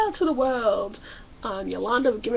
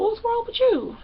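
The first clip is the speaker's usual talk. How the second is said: pitched way up to imitate her dad; mock father voice; 11 semitones higher than usual. Mocking the dad answering her question, squeaky high voice. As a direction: squeak "What's wrong with you?" far higher, like a mocked dad. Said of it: "Trinity's pop go".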